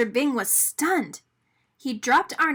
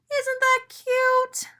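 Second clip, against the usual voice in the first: very high, slow and cooing; delighted; 14 semitones higher, 4 dB louder, much slower than usual. A narrator's reading. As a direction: gush over the sweet moment. Arnie delighted being lifted.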